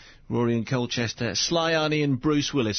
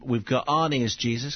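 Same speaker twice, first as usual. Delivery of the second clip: normal talking voice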